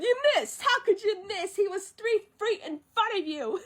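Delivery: high, outraged squawking sidekick; Mushu. Retold or imitated. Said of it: "Mushu said".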